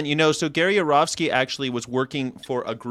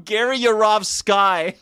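laughing; 8 semitones higher, 3 dB louder, slower than usual. Real, not acted. Laughing at his own joke.

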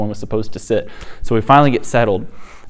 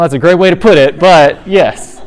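laughing agreement; 7 semitones higher, 9 dB louder than usual. Retold or imitated. Laughing reply to friend.

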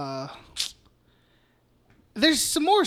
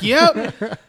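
a loud laughing yep; chuckled yep; 3 semitones higher, 7 dB louder than usual. Retold or imitated. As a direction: laugh out the answer. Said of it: "He's like".

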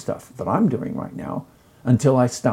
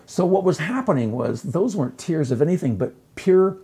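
normal talking voice

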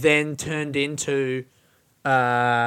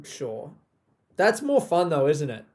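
ordinary delivery

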